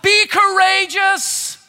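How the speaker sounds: climbing call that swells at Fear; rising exhortation. Real, not acted. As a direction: build the cry as the sermon peaks. Preacher building to climax.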